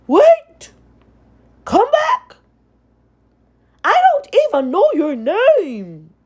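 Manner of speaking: high, loud desperate call; prince calling out. Acted. The prince shouts after fleeing Cinderella, high and loud. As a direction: call after her as she runs.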